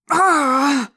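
long, loud, high cry of pain; agonized scream. Acted. Teacher clutching his head, screaming. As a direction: scream breaking out mid-sentence.

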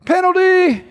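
high, sustained penalty call; mock referee's high cry. Mimics referee calling out a foul.

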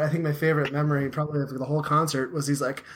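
usual speaking voice

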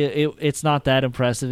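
ordinary talk

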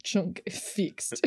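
put-on terse Belarusian-accented report; mock Belarusian accent. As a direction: imitate the Belarusian coworker, word by word. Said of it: "you hear Perfect Paradox".

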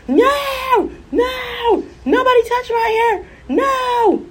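put-on very high screaming little boy; imitating a child's shrieking no. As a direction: shriek like a toddler refusing. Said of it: "this little boy screamed".

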